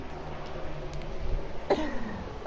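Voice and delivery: high, airy laugh of disbelief; soft wheezing laugh; breathless laugh. Real, not acted. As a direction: laugh it off, stunned. Stunned at reaching Super Bowl.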